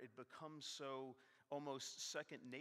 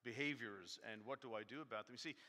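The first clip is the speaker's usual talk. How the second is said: usual speaking voice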